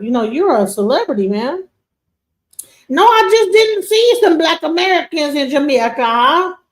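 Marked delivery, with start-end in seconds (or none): 2.9-6.6 higher, louder Grenadian patois; mock her in Grenadian patois. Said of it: "In her Grenadian, uh, uh, pa-, patois".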